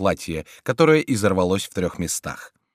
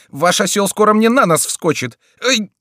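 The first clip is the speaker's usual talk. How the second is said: much higher, loud frantic complaints; high panicky Friar; 10 semitones higher, 6 dB louder than usual. A narrator's reading. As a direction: shout at the riders in panic. Friar crushed in crowd.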